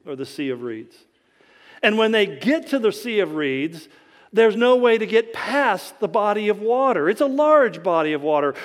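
usual speaking voice

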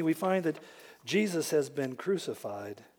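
usual speaking voice

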